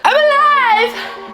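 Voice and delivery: high slow joyful cry; triumphant shout. Real, not acted. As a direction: cry out in relief. Relief after spice.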